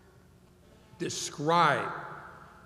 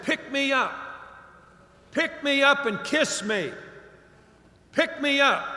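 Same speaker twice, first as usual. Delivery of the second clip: higher, louder calling voice, lightly voiced; mysterious frog voice; 7 semitones higher, 3 dB louder than usual. A hidden frog calls up to the old fisherman, higher and louder. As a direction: call out from nowhere to be picked up. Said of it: "And the voice said".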